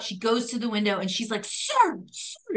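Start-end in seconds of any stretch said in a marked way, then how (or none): 1.5-2.6 mock scream. Woman confronting a driver at his window, mock screaming. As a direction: imitate an irate shriek at half volume. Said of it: "she's screaming in his face".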